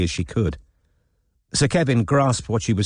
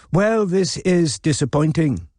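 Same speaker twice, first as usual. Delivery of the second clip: slow, lightly grand and mellifluous; Della's grandeur; 8 semitones higher, slower than usual. Acted. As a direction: play a grand lady, faintly let down. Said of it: "a touch of Della's mellifluous grandeur".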